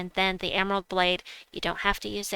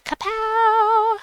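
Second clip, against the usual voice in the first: high, loud comic kapow; cartoon exclamation; 9 dB louder than usual. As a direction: shout it like a comic-book blast. Explosion sound effect shout.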